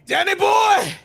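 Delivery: high hammed-up call; theatrical call. Signing off the show, hammed-up call. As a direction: call the name like a stage farewell.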